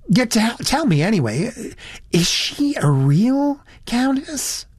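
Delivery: put-on high, swooping, indignant girlish voice; worried American girl. A narrator's reading. Elizabeth to Satterthwaite about Franklin, high and worried. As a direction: gush the worry in a high American voice.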